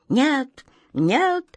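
sharp high cry; piercing cry. A narrator's reading. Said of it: "тихо и пронзительно вскрикнул Каргор".

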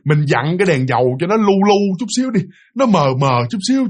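ordinary delivery, nothing marked

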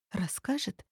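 hushed, whispered question; whispered thought. Acted. Lilya wonders whether Valer will tell, whispering. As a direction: whisper it to yourself.

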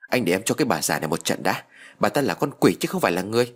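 plain reading voice

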